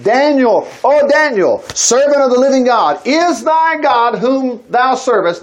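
a king's anguished cry to the lions' den; lamentable cry. Acted. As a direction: cry out to Daniel in grief across the den. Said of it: "he cried out in a lamentable voice".

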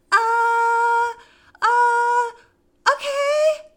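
high, loud strained panic; freaked out. Retold or imitated. Retelling the moment they caved on the spot, freaked out. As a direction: blurt it out high and flustered. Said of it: "I freaked out in the moment".

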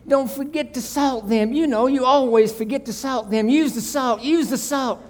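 high-pitched fretful nagging, building and falling; fussing husband. Acted. Husband bursting into the kitchen to nag his wife about eggs, high and frantic. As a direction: hover and nag like a panicky backseat cook. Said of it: "he said".

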